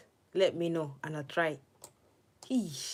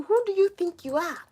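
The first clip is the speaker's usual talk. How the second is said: high, sassy challenge; mock indignant; 12 semitones higher, 6 dB louder than usual. Gamer taunting a rival snake, sassy high challenge. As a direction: square up to the snake in a put-on voice.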